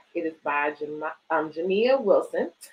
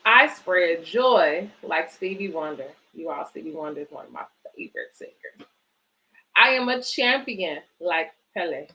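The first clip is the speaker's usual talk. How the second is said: put-on reading to children. Reads picture book aloud.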